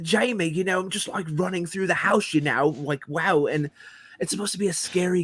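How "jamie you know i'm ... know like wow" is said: mocking, higher-pitched British chatter; Russell Brand. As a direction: imitate a rambling English comedian in a raised voice. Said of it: "lead voice actor sounded like Russell Brand".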